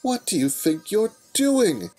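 slow, high, loud demand; scolding. Acted. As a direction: demand an answer, alarmed. Scolding Spike.

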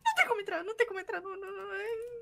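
high, dwindling panicky protest; frightened whimper. Real, not acted. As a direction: plead softly, scared to go on. Refuses to go in, alien near. (lamúria de medo)

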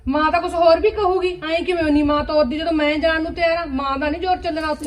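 heated retort. Acted. Wife argues with husband.

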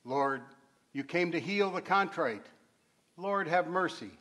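loud, ringing church reverb; cathedral echo. Real, not acted. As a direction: intone it into a big stone nave. Deacon intoning invocations in church.

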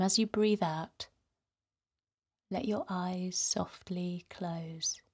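ordinary talk, nothing marked

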